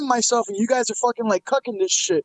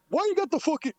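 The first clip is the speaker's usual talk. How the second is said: raised-pitch mock complaint; E B Z impersonation; 7 semitones higher, 4 dB quieter than usual. Streamer quoting the man objecting to his camera, mock complaint. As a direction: start an irate objection in a higher put-on voice. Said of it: "let me do my E B Z impersonation".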